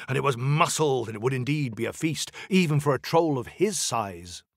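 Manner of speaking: ordinary delivery, nothing marked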